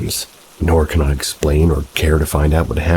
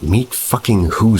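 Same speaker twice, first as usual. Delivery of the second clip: pissed off; 6 semitones higher than usual. Retold or imitated. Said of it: "I'm kind of a bit pissed".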